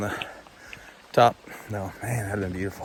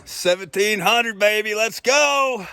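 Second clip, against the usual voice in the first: excited cheer; 9 semitones higher, slower than usual. Real, not acted. Cheering at the summit height.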